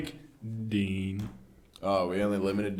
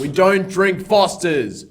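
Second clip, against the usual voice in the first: louder, slower, each word hammered; emphatic; 6 semitones higher, 11 dB louder, slower than usual. Real, not acted. Ranting to friends about Fosters, loud and slow. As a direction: insist on it loudly.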